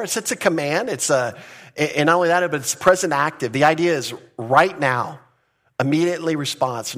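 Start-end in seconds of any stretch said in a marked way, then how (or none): none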